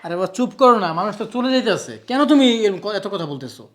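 loud exasperated shout. Acted. Husband shouts at his wife to stop while his sister leaves, loud exasperated shout. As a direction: yell at her to stop.

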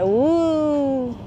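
long, high ooh of wonder. Acted. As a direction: draw out a mysterious rising ooh. Imitates wonder of what's out there.

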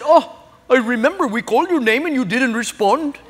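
put-on East Indian accent. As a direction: East Indian accent, as the gate agent explaining. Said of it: "from an East Indian accent".

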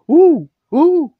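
deux ouh très forts et aigus; cri de fantôme plus fort. Acted. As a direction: crie pour faire sursauter. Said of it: "plus fort". (Gaspard crie encore ouh, plus fort)

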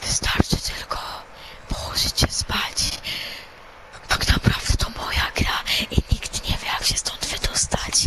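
mysterious whispered voice; soft, voiceless whisper; Sterling. Pretend. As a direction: whisper like a hidden presence.

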